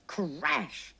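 ordinary delivery, nothing marked

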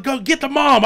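high, loud retold command; frantic order. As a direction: shout urgent instructions to your brother. Frantic order to brother on phone.

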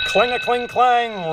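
loud bell imitation; loud, ringing storybook bell; clanging bell. A narrator's reading. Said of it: "rings the bell".

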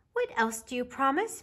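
put-on high, small child's voice; little bear voice. A narrator's reading. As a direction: voice a curious little cub.

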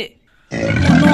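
loud, drawn-out dismay; a startled cry at the sight; alarmed oh no. Pretend. Sees crocodile on remote. (चौंककर ज़ोर से)

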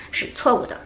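ordinary talk, nothing marked

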